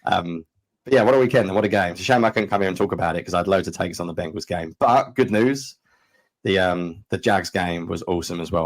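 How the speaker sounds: ordinary talk, nothing marked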